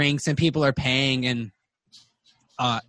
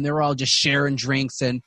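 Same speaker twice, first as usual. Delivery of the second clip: normal talking voice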